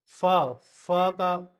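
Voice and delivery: फा drawn out very slowly; F sound of V. Shown as a demonstration. Teacher shows students the F sound, very slow फा. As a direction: stretch फा, फा. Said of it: "फा का sound आता है".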